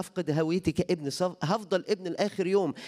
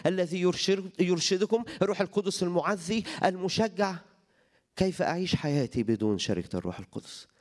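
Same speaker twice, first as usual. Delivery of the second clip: ordinary talk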